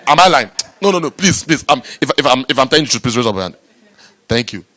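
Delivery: usual speaking voice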